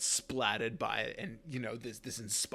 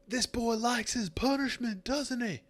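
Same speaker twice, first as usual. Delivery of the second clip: high mocking sneer at the recruit; taunting drill instructor; 12 semitones higher, 4 dB louder than usual. Instructor mocks the cadet for failing again. GM voices gif taunting player.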